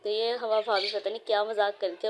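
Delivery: normal talking voice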